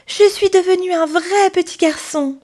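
high, loud cry of delight; overjoyed boy. Acted. Pinocchio tells his father he is real, overjoyed. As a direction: announce it bursting with joy.

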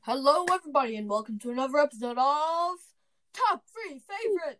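big bright announcer opening the show; game show host. Acted. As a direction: boom it out like a TV quiz host. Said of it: "before I do the game show voice".

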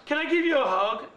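loud, high pleading question; imitated eager request. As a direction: call it out high, mimicking an odd passenger. Rider asks driver for a hug.

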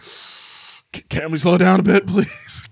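exaggerated pleading. Brother begging sister to ease up, mock pleading. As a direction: beg with a breathy stutter, hammed up. Acting out plea to smoking sister.

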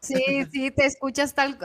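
ordinary talk

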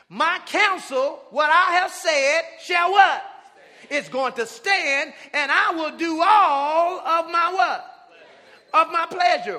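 loud, slow, rising call to the church; preaching at full force. Acted. Pastor thundering scripture at the church, preaching at full force. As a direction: hammer each phrase out to the congregation.

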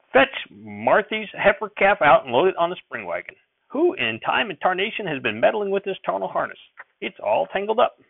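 put-on high, fussing old farmer; flustered grandfather. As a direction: grumble over the tangled harness.

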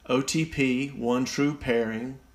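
usual speaking voice

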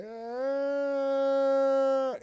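imitated high, drawn-out wail; one long, high, sustained crying yell; weird ugly cry. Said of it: "It was the fucking weirdest cry".

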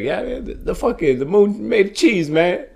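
high put-on voice of the homeless man; laughing impression of the guy's insistence; mocking the homeless man. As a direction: lift the voice into a silly impression. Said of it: "the guy goes like".

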